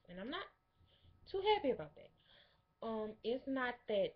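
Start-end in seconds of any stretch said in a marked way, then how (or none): 0.3-2.1 high-pitched complaint. Real, not acted.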